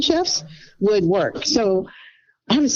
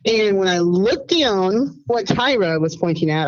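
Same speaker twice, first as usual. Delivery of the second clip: ordinary talk, nothing marked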